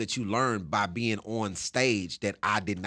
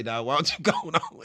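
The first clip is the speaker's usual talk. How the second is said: laughing; 5 semitones higher than usual. Retold or imitated. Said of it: "he laughs just say".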